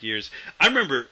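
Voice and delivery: usual speaking voice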